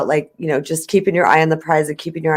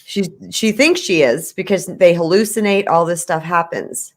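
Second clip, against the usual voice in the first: ordinary delivery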